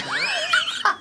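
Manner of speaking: sudden shrieking laugh. Real, not acted. Telling the hosts about meetings with plants, shrieking laugh. Bursts out laughing at own story.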